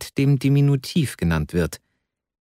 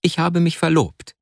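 higher-pitched solemn confession; earnest, raised-pitch revelation; dignified announcement; 6 semitones higher than usual. A narrator's reading. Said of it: "seinen Worten eine gewisse Würde zu verleihen".

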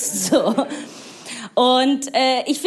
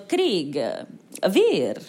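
mock puzzled Italians; 4 semitones lower than usual. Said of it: "Italiener sind so".

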